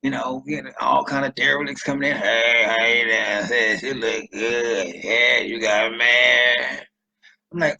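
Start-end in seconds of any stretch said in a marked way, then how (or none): 2.2-6.8 very slow, drawn-out, louder pickup lines; a creep sliding up to her in line; derelict flirting. Retold or imitated. A derelict in the welfare line hitting on her, slow creepy flirting.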